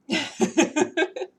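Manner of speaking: high, loud laugh. Real, not acted. Cat waving its tail in her face, laughing. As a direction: laugh in delight.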